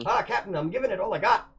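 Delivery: high, loud Wisconsin engineer; Scotty with a Wisconsin twang; Wisconsin accent. Acted. Engineers answering the captain in a Wisconsin accent. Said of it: "in the Wisconsin accent".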